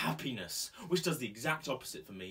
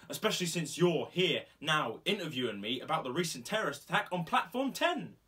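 loud, high, building indignation; exasperated outburst; 7 semitones higher, 5 dB louder than usual. Acted. Coffee shop owner protests to interviewing sergeant, loud exasperated outburst.

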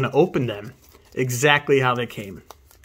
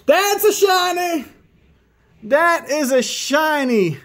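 high, slow, loud shout of joy; ecstatic shout; 6 dB louder, slower than usual. Real, not acted. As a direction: scream it, you finally got it. Pulls the shiny card he wanted.

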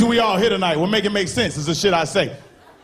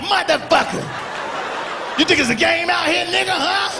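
angry homeless man; 9 semitones higher than usual. Acted. Homeless man yelling at the comic who asked him for change. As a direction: snap back like an offended homeless man.

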